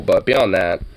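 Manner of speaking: normal talking voice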